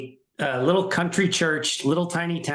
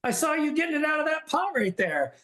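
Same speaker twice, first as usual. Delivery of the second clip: retold disbelief, much higher; a mock baffled protest, pitched way up; 10 semitones higher than usual. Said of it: "And I said".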